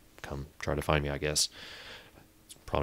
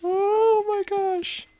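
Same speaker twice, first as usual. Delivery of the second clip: shaken; 4 dB louder, much slower than usual. Real, not acted. After a scare.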